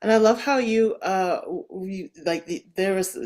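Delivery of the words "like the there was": normal talking voice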